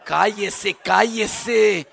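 building from plain to loud; silencing shout. Retold or imitated. Husband answering a wife who compares him to the pastor, building to a shout. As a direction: shut her up, rising to a yell. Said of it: "yo como quisiera que fueras como el pastor".

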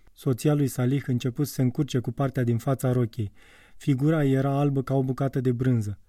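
usual speaking voice

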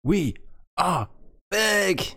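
excited. Acted. Podcast return, hyped opening.

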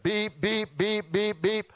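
high, pinched electronic beeping; quick high beeps like a fire alarm; imitated alarm beeps. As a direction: mimic the hotel alarm again. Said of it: "I hear this loud horn going off".

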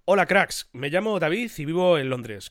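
brighter read-aloud voice; reading a letter aloud. Acted. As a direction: read it out to the listeners. Reads out listener's letter.

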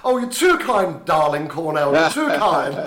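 playful over-the-top modesty; hammed-up luvvie. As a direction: gush like a theatrical darling, on the edge of laughing. Host hams up luvvie thanks.

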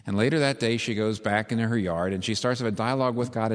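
ordinary talk

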